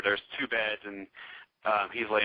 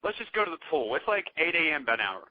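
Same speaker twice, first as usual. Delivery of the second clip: higher, lightly voiced girl venting; mock girl voice; 6 semitones higher than usual. Meg telling the guys to ignore the woman, a higher mock girl voice. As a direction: pitch it up like an upset girl.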